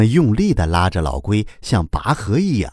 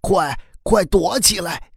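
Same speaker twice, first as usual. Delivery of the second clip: high, slow, hushed-down urgency; urgent summons; 9 semitones higher than usual. A narrator's reading. Old turtle urging the children to hide, urgent high call. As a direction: urge them to hide, pitched high without shouting. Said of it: "便招呼着孩子们".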